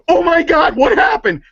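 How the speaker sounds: high, loud cry of alarm; retelling a friend's startled yelp, hammed-up high; shocked alarm. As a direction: gasp it out like you just found your friend sobbing. Friend shocked at her crying.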